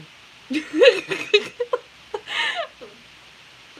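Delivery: long, high, loud laugh. Real, not acted. Laughing at her ex asking her out. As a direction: let a long laugh roll out.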